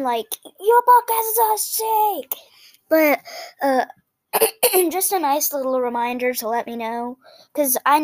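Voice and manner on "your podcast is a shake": mock angry message. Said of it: "not an angry one".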